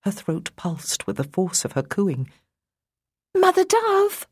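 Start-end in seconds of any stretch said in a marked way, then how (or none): 3.3-4.3 high, loud fairy cry; cried out. Acted. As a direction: call out high and bright, breathy. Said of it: "someone cried".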